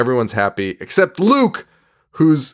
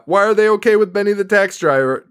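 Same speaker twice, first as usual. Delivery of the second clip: usual speaking voice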